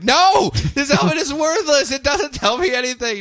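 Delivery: high, fed-up whine; whiny Khalid. Retold or imitated. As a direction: complain the helmet is useless. Khalid voiced complaining.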